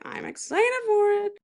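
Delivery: high, squeaky excited voice; squealing excitement. Shown as a demonstration. Hyping the Companion trailer to listeners, high excited squeal. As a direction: squeal it with glee.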